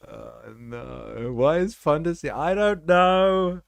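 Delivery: high, slow bewildered questions; baffled, dragging out each question. Real, not acted. Bewildered by the stream.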